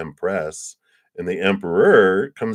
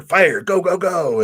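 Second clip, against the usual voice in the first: high, loud burst of energy; punchy, excited cheer; fiery urging; 12 semitones higher, 5 dB louder than usual. Acted. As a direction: act out an impatient go-getter. Acting out the partner's fiery drive.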